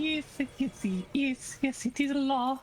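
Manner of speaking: high, silly proclaiming; high joke voice. Acted. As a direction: chirp it out squeaky. Matching the joke voice.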